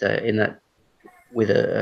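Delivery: usual speaking voice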